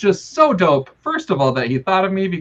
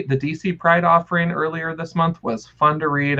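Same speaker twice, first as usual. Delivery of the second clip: usual speaking voice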